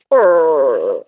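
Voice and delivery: low rolled brr sound; imitating a low goose call. Showing how Nugget goes, low brr. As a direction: mimic her low brr. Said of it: "She goes".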